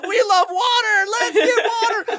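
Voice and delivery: very high, loud, laughing cheer; mock crowd cheer. Whole crew cheering.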